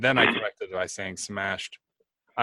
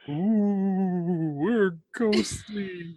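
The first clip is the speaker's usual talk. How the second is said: high, drawn-out mock ghost wail; spooky ghost; 8 semitones higher, much slower than usual. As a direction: play a silly ghost, slow and high. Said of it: "dress up as ghosts".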